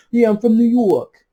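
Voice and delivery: the Massachusetts boyfriend faked with a New York accent. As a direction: play a brash guy with a New York accent. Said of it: "so let's change it to New York".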